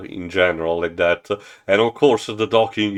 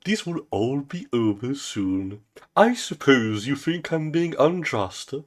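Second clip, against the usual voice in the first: lightly voiced smug tyrant, higher; a touch of smug villain; 8 semitones higher than usual. President Zog gloating to his assistant, smug and higher. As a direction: savour the power, smugly.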